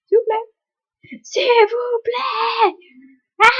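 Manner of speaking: high, loud pleading cry; desperate begging shout. Real, not acted. Player begging the game to work, crying out loud. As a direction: beg the game at the top of your voice.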